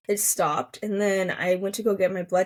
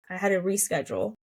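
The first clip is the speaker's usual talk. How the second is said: ordinary talk, nothing marked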